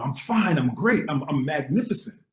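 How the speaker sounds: brightly overconfident, as if retelling a manic high; exuberant reassurance. Retold or imitated. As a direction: re-enact giddy, grandiose confidence. Retelling his manic reassurance to roommate.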